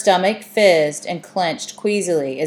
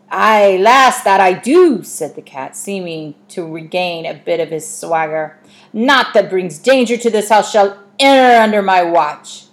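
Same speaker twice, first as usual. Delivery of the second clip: loud swaggering pirate brogue; boastful old sea-dog cat; 7 dB louder than usual. Acted. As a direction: puff up like a proud ship's guard. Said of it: "Sometimes he thinks he's a pirate".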